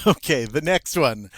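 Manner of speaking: ordinary delivery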